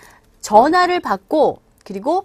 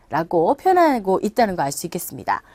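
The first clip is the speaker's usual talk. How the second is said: ordinary talk, nothing marked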